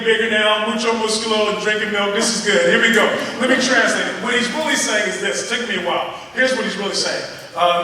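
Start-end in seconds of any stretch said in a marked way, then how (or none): none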